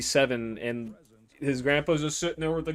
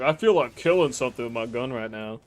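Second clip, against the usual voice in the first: mock psychopath thoughts. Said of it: "Like, actual just psychopath".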